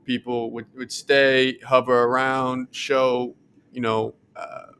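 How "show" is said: usual speaking voice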